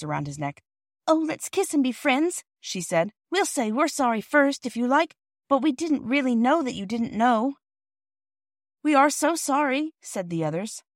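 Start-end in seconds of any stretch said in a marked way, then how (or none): 1.1-2.4 put-on coaxing little girl. Girl makes up with porter. 3.3-7.6 put-on high, earnest child voice; coaxing, apologetic girl. Girl apologizing to the porter, high and coaxing. 8.8-9.9 put-on apologetic children.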